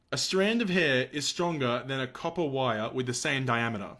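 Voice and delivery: ordinary delivery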